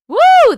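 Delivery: high, very loud whoop; excited woo. Real, not acted. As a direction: burst out with a woo. Reacting to a good story.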